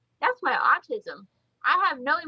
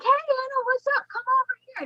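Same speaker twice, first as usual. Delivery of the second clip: much higher, eager greeting and invite; friendly Chase; 11 semitones higher than usual. Retold or imitated. Chase inviting Anna over to his booth, high eager voice. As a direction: bubbly welcome calling her over. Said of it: "Chase over there who's like".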